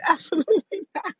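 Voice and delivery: laughing no to her inner voice; laughing refusal. Acted. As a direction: laugh while shutting it down. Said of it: "I crack myself up".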